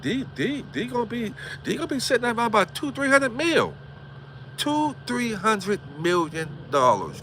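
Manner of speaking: high-pitched gush over the millions; excited. Real, not acted. Host hyping a viewer's future fortune, excited. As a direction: pitch it up, thrilled for him.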